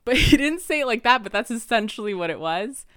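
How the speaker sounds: laughing correction about the boss. Real, not acted. Laughs about boss's quote.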